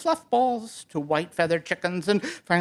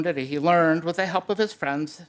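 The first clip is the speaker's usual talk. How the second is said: usual speaking voice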